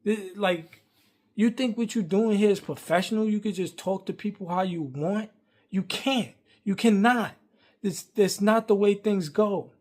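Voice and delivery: loud, high-pitched angry telling-off; going off. Retold or imitated. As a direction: re-enact chewing someone out, hitting each refusal hard. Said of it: "going off on him".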